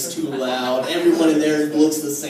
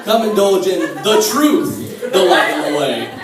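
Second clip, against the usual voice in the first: mock priest; 3 semitones higher, 5 dB louder than usual. Fantasy, speaker as seductive priest.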